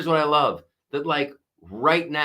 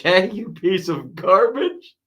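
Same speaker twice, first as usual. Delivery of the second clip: laughing; 7 semitones higher, 5 dB louder than usual. Retold or imitated. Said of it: "Al says".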